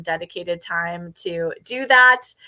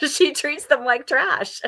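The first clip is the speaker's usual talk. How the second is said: chuckling through the words; a light laugh breaking into the line; 8 semitones higher, slower than usual. Real, not acted. Host laughing to viewers about trashing bad books. Laughing at her own remark.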